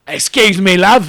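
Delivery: put-on booming, botched Russian accent; hammed-up awful Russian accent, very loud; Ray Winston. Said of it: "His awful Russian accent".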